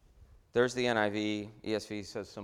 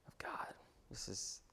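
whispered awe; 18 dB quieter than usual. Acted. Preacher hushes the congregation to a whisper over the verse.

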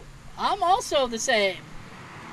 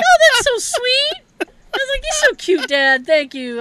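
much higher, gushing and touched; mock sweet voice; 14 semitones higher, 7 dB louder than usual. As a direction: squeal it like you're flattered. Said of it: "So I'm like".

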